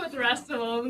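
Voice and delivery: ordinary delivery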